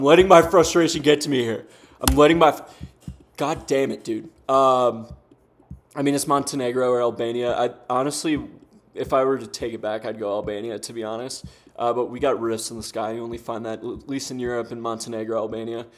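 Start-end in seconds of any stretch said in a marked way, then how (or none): none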